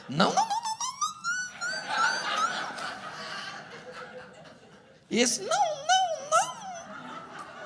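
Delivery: very high, thin repeated no; squeaky refusal. Acted. Wife refusing to keep arguing with husband, high squeal. Acts wife's exasperating refusal to argue.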